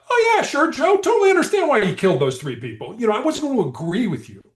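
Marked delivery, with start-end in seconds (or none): 0.1-3.0 high, louder, sarcastic gushing; overdone sarcastic agreement; mock agreement. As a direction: fake total sympathy. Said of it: "I was gonna be like".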